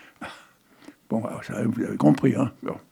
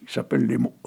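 ordinary talk, nothing marked

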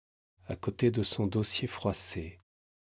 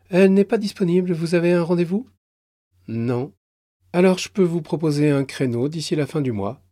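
plus haut et plus rapide, poli; débiter les consignes vite; guichetière pressée; 8 semitones higher, 7 dB louder, faster than usual. Acted. Guichetière refuse poliment. (guichetière qui expédie poliment)

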